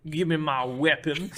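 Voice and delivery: loud mock-Southern movie villain; Southern accent. Quoting the bad guy's fantastic line, in a hammed-up Southern accent. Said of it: "he sounds weirdly, uh, Southern".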